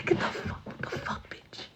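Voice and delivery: urgent hiss in the dark; whispered scolding. Retold or imitated. Friend hissing outside the house at night, urgent whisper. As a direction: whisper it like you could be caught. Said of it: "I'd be the friend".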